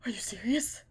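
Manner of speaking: stunned whispered disbelief; a shaken whisper. Retold or imitated. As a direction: breathe it out in shock. Shock at ten thousand feet.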